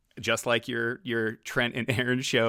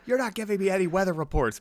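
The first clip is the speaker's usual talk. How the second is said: high-pitched imitation of a scolding boss; mock yelling boss; 9 semitones higher, faster than usual. Boss scolding young airman over weather reports, mock yelling. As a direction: mimic a boss's raised-voice complaint. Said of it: "is yelling at me".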